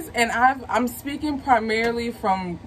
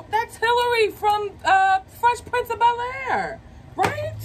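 thrilled; 11 semitones higher than usual. Real, not acted. Recognizes actress, thrilled.

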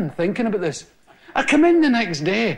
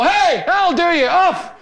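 higher, quick call to go off; brusque stage manager; 9 semitones higher than usual. Retold or imitated. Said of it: "They go".

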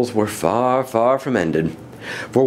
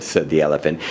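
usual speaking voice

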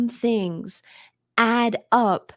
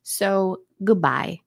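usual speaking voice

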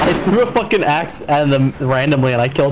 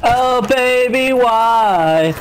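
inside a well; 6 semitones higher, slower than usual. Real, not acted. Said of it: "you sound like you're in a well".

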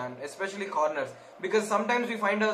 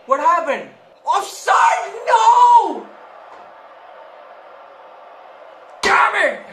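much higher and louder angry yells; furious shouting; 12 semitones higher, 11 dB louder, slower than usual. Real, not acted. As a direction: rage at the offside call. Goal ruled offside.

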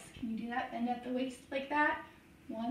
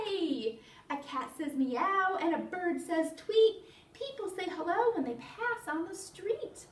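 animated, sing-song picture-book reading; playful storytime narration with stretched key words; reading to children; 8 semitones higher, 5 dB louder, slower than usual. Acted. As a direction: read it to toddlers with lively, swooping pitch.

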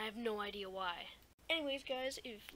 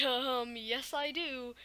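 loud, cheeky, higher retort; defiant reply; 4 semitones higher, 6 dB louder, much slower than usual. Retold or imitated. Student loudly and cheekily defying the strict teacher. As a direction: talk back with cheek.

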